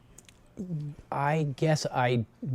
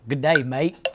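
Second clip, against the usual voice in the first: Australian accent; 4 dB louder than usual. Shown as a demonstration. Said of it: "say good day, mate, in an Australian accent".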